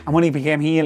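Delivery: ordinary talk, nothing marked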